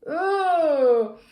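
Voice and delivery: very loud, high, wailing oh; disgusted bellow. Acted. Mother hit by the smell, bellowing in disgust. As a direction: reel from the stench. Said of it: "she bellowed as the pong hit her".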